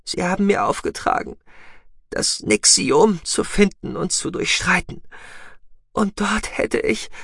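put-on high, fragile young woman near death; urgent but failing strength; dying young woman. Voices Sahira, dying.

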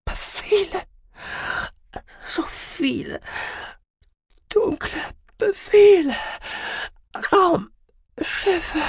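very high, strained fragments; tormented, halting trance voice; delirious patient. A narrator's reading. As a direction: gasp out single words between long pauses. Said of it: "murmelte sie".

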